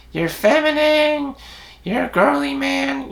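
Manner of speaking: high mocking jeer at a girly man; high taunting mock voice. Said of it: "People are gonna call you out".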